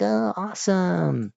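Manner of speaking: put-on high, drawn-out childlike awe; slow, high mock amazement; delighted kid. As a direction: imitate a kid marveling at a drawing. Imitates delighted kids getting a drawing.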